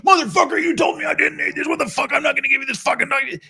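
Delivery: high, furious yelling at the officer; enraged shout. Retold or imitated. Angry expat yelling at an immigration officer, high furious shout. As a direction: scream it like you've lost it with the clerk.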